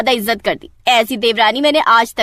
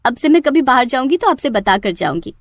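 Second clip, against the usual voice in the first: ordinary talk, nothing marked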